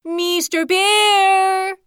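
long, high, stretched-out call across the river; a small creature's drawn-out yell; hedgehog's greatest shout. Acted. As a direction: shout to catch a bear's attention. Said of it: "he mustered the greatest shout".

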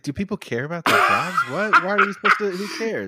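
high, incredulous mock reply; mock baffled. Host voicing the guest answering his boss, mock baffled. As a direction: play dumb about caring for jobs.

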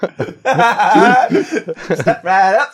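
loud, high barker call; loud carnival barker. Pretend. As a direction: call in the crowd at a fair. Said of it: "carnival barker".

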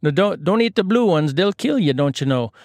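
high, hammed-up Canadian accent. Islander warning a fellow berry picker, comic Canadian accent. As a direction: warn in a mock Canadian accent, starting high. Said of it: "done with the Canadian accents".

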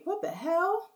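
high, stunned disbelief; reliving the moment the truck hits her; shocked. Retold or imitated. She reacts as her car is hit, shocked.

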